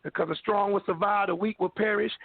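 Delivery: ordinary delivery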